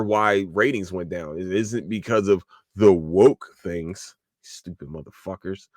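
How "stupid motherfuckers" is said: much softer, under the breath; muttered insult. Real, not acted. Vlogger muttering about anti-woke viewers, soft contempt.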